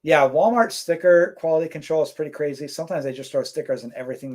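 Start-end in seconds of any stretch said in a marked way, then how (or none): none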